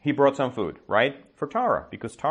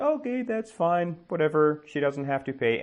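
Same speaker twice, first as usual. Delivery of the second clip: high, offhand and easy-going; breezy shrug; 6 semitones higher than usual. Retold or imitated. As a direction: wave the whole thing off lightly. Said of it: "And he's like".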